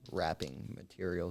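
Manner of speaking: normal talking voice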